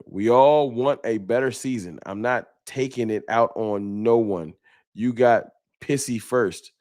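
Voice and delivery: ordinary talk, nothing marked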